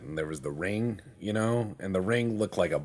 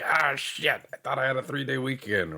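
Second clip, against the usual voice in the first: disappointed workers; 6 semitones higher than usual. Retold or imitated. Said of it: "They're like".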